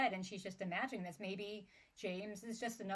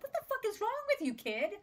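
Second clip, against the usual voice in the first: fast, much higher, louder disbelief; exasperated outburst; 13 semitones higher, 6 dB louder, much faster than usual. Retold or imitated. Reader reacting to a blasé child, fast exasperated outburst. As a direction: blurt it out, appalled at the kid. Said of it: "I'm like".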